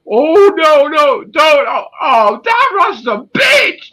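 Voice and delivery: high, loud, shrieking cry; mock panicked shout. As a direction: scream in hammed-up panic.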